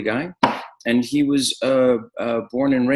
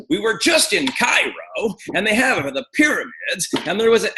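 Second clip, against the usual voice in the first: uncle Bruce impression; 11 semitones higher than usual. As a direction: open up and wave them into the story. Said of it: "everything he says is kinda like this".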